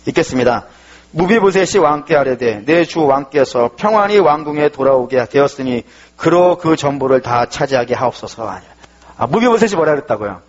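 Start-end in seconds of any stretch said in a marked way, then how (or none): 1.1-8.7 성경 구절을 천천히 또박또박 낭독; slow, measured Bible reading; scripture reading. Acted. Reads scripture aloud. (천천히 낭독하듯)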